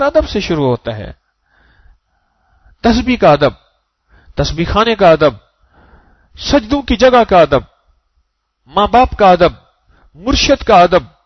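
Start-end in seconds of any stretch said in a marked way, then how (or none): 2.8-11.2 preaching cadence. Acted. Preaching list at full force.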